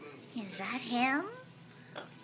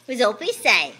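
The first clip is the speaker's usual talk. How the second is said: usual speaking voice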